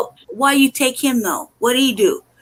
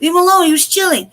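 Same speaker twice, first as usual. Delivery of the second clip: higher-pitched retold protest at a window; mock calling-out, pitched up; 7 semitones higher than usual. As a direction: reenact calling through the window to make them stop. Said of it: "banging on the window".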